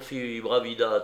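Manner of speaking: plain reading voice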